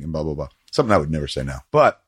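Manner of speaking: ordinary talk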